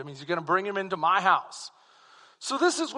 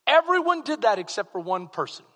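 ordinary delivery

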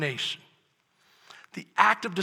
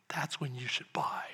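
whisper; 4 semitones lower, 8 dB quieter than usual. Acted. Secret tip to the congregation.

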